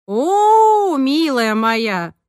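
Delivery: long, high, loud "oh", then slow; whistling amazement. A narrator's reading. Roza reacts to being asked to persuade Irena, amazed and drawn out. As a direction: let out a drawn-out whistle of a reply. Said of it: "совсем по-мальчишье присвистнула Роза Васильевна".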